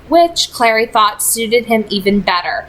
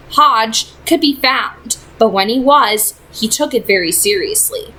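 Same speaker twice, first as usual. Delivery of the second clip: ordinary delivery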